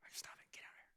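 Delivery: put-on whispered stop it to the boys; hushed scolding. Dad whispering at boys fighting on his lap, hushed scold. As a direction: hiss it at squabbling kids.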